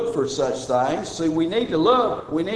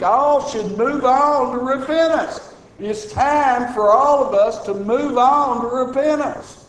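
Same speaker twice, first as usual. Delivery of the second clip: raised, ringing pulpit voice, pressing the point; preaching at full force; 7 semitones higher, 3 dB louder than usual. Acted. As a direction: preach it out to the whole room, higher and louder. Preaching to congregation.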